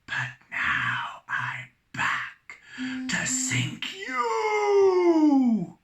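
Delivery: slow whispered ghost wail; ghostly whisper. Acted. Ghost threatening the captain, whispered wail.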